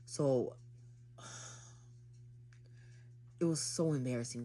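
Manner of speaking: ordinary talk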